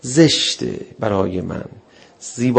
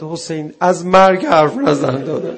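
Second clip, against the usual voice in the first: mock cry out; 4 semitones higher, 6 dB louder than usual. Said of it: "تو اون صیحه فقط داد زد".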